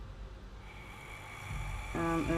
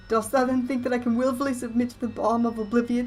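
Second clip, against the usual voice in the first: ghost voice; 6 semitones higher, 6 dB louder than usual. Acted. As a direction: moan it like a wronged ghost. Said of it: "ghost voice".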